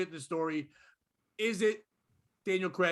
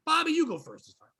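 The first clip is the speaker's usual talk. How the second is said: higher, louder call to cohost; 7 semitones higher, 5 dB louder than usual. Real, not acted. Host hands the next turn to Bobby, calling out.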